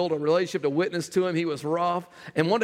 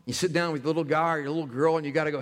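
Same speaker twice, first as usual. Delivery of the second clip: ordinary delivery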